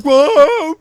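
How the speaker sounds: high, loud retold cry of alarm; mock alarmed yell. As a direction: act out a startled wail. Acting out reaction to bleeding.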